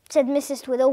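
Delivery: ordinary delivery, nothing marked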